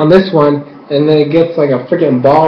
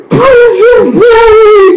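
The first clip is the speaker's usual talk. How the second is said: very high, loud drawn-out yell; mock kid's shout; 5 dB louder, much slower than usual. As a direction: screech it slowly like an overexcited child. Acts out kid Milo shouting.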